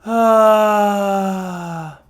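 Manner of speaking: airy high ah with the breath leaking out; breathy off-voice ah; breathy sigh. Shown as a demonstration. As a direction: let too much air escape on a high ah. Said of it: "Is your voice breathy?".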